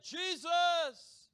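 high, loud drawn-out shout; yelling out. Acted. Preacher acting the blind beggar calling out, yelling. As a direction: yell the name at full voice. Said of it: "He begins to yell out".

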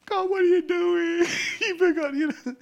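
a desperate plea tossed up at heaven; crying out to God. Retold or imitated. Prisoner in the storm crying out to God in despair. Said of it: "starts crying out to God again".

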